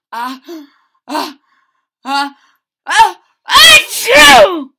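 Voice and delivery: rising ah ah into huge loud achoo; building sneeze. Acted. Andrew sneezing from the pepper, building to a huge sneeze. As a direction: build up then blast the sneeze.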